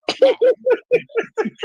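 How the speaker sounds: a sudden high cackle of laughter; high burst of laughter. Real, not acted. Scoffing at her ex, a high laugh. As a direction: laugh high and free at the thought.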